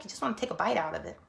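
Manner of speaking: ordinary delivery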